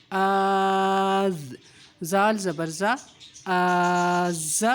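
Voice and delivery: very slowly held آز, the alif stretched long; long madd. Shown as a demonstration. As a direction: pull آز out on the madd. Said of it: "madd shown". (آز کو مد کے ساتھ خوب کھینچ کر)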